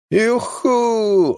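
high, drawn-out whoop; loud whoop. A narrator's reading. Jim whooping on a wild drive, high joyful call. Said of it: "odkrzyknął Jim".

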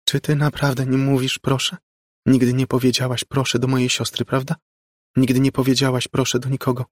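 put-on high, scolding child voice; reproachful little girl. A narrator's reading. Ghostly girl chides Margo over manners, high reproachful voice. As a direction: chide an adult as a prim child.

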